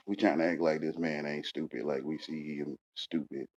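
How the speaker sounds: confiding mutter to the listener; hushed aside. Acted. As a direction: drop it down low like a secret.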